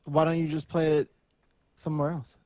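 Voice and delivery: usual speaking voice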